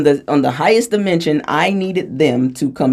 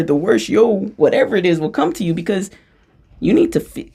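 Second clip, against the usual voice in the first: ordinary talk, nothing marked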